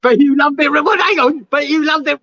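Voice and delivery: high, loud teasing jibe; mock teasing. Ribbing about enjoying the strip search, mock teasing.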